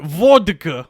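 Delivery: drawn-in, practised Russian voice; Russian accent. Shown as a demonstration. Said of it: "see if I can get into it".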